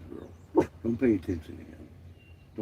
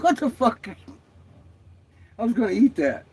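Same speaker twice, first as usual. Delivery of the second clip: higher and louder mock outrage; retold indignant surprise; 12 semitones higher, 4 dB louder than usual. Retelling the dog peeing on her pumpkin, high mock outrage. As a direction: squeal it like your snack got ruined. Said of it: "I was like".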